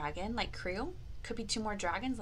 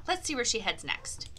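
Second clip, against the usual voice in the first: ordinary talk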